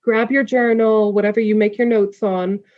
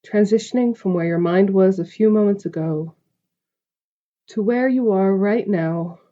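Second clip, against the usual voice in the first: calm, spacious meditation delivery; guided meditation. Acted. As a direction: guide the listener gently into stillness, stretching the breath cues. Leads a meditation.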